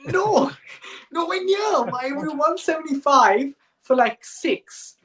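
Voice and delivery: high-pitched, laughing mock disbelief; incredulous protest. Fan answering the commentator about a hopeless score, incredulous.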